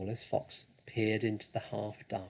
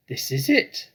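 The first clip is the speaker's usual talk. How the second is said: loud, pleased exclaim; excited cry; 4 semitones higher, 9 dB louder than usual. A narrator's reading. Fox finds the cellar, crying out excitedly. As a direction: cry out in triumph. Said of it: "cried Mister Fox".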